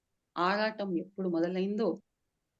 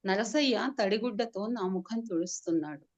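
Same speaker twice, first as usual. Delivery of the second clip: usual speaking voice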